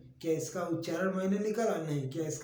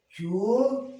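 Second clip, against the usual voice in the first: चोर called out much louder and higher; loud demo word; 4 semitones higher, 6 dB louder, much slower than usual. Shown as a demonstration. Teacher answering his own question, calling चोर loudly. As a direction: belt out चोर for the class. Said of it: "तो देखिए".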